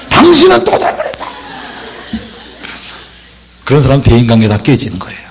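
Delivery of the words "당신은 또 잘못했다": very high-pitched, exaggerated accusing voice; mock counter-accusation. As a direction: throw the blame back, pitched way up. Wrong way: turning blame back. (과장되게 높은 목소리로 되받아 탓하기)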